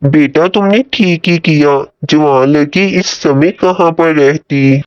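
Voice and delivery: lightly voiced mother; approving mother. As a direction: speak as a reassuring mother to her son. Voices Ali's mother in story.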